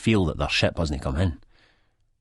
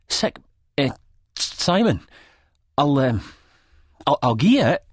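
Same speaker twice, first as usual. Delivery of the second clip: much higher, halting, flustered; nervous stammer; 10 semitones higher, 4 dB louder than usual. A narrator's reading. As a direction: scramble for words under pressure. Renton cornered, stammering.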